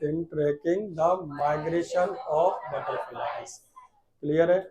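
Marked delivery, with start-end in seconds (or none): 0.0-3.5 slow, drawn-out words; slow dictation. Shown as a demonstration. Teacher dictating slowly to the class. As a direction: stretch each word for the class. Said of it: "साथ में बोला करो बेटा".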